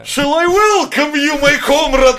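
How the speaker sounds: put-on high, slow, grand old English; posh English. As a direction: read it like a pompous Shakespeare actor. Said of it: "Я ему написал".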